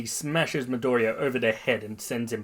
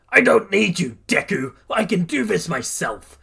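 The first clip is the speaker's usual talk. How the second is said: high, loud angry protest; defiant shout; 7 semitones higher, 6 dB louder than usual. A narrator's reading. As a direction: shout it defiantly before attacking. Bakugo shouts from behind.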